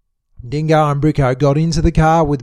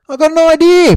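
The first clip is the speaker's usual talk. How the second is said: high, loud burst; excited; 11 semitones higher, 6 dB louder than usual. A narrator's reading. Bricko to Dingo, high and loud with excitement. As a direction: blurt the idea out. Bricko announces plan loudly.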